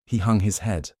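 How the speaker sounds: ordinary delivery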